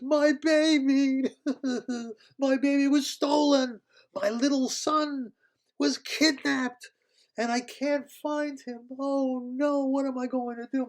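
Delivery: high, loud pleading wail; distraught queen. Acted. Weeping dragon queen begging the puppies, high desperate wail. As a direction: cry out for the lost child.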